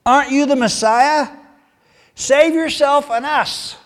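loud, high mocking taunt; insulting jeer. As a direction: criminal on the cross jeering at Jesus, loud and mocking. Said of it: "hurled insults at him".

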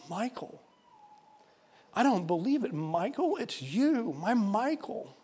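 high, amazed, slowing to the end; astonished joy. Retold or imitated. Old woman recognizes her first love, high and astonished. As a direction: disbelief melting into joy. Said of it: "She gasped".

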